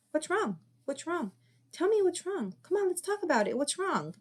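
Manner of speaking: put-on high, pressing parent's voice chasing the kid; pushy parent. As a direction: keep pushing the child to open up. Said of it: "Some parents will chase their kids around".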